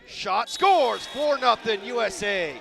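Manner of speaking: loud, high-pitched commentator shout; excited goal call. Real, not acted. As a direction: explode on the goal, then ride it through the score. Calling a goal live.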